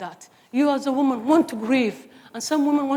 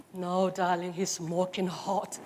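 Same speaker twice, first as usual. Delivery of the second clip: soft teasing; 4 semitones lower, 7 dB quieter, slower than usual. Retold or imitated. Mother teasing daughter.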